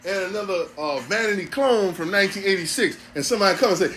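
ordinary talk, nothing marked

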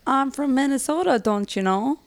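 put-on Minnesotan accent. Guest showing how Minnesotans talk, in Minnesotan accent. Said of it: "saying was that Minnesotans and Canadians say".

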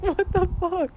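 shocked, high-pitched outburst; high, alarmed what the fuck; startled. Retold or imitated. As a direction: react like you just stepped in something gross. Startled by stepping in vomit.

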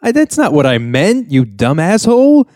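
high-pitched sputtering retold outrage; mock losing it. Retelling dad's reaction to dropping out, mock losing it. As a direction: sputter upward like an exasperated TV dad. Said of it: "Carl loses his shit even more".

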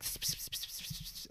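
hissing psst psst under the breath; whispered gossip hiss. Retold or imitated. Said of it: "they're like".

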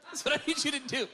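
loud, high laughing delivery. Real, not acted. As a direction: push the words out through laughter. Laughing while telling the cat story.

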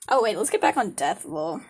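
ordinary talk, nothing marked

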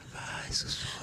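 barely audible whisper; playful whisper. Pretend. Host eggs guest on to whisper, very hushed whisper. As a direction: urge them on in a hushed whisper.

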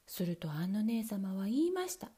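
usual speaking voice

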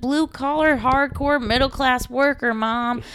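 put-on high, proud kid calling home; earnest kid on phone. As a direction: play a proud patriotic kid. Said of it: "kids calling home like".

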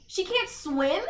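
high, loud retold disbelief; hammed-up incredulity; incredulous question. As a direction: replay your astonishment as a question. Replaying disbelief at the plot.

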